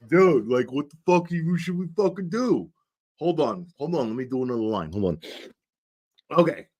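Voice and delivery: a loose mock New York accent for a drunk conspirator; a drunk New Yorker plotting, lightly put-on New York accent. Host mimics drunk conspirators planning a frame-up, New York accent. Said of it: "It's a New York accent".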